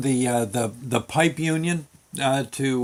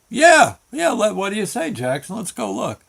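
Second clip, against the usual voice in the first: eager agreement; 6 semitones higher than usual. Retold or imitated. Eager reply to manager.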